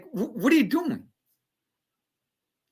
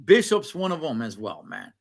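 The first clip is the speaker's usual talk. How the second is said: ordinary talk, nothing marked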